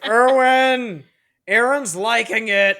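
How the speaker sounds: high, loud cartoonish report; parody character voice. Retelling an anime parody, loud high cartoon voice. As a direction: squeal it out like a gag dub. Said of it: "He was like".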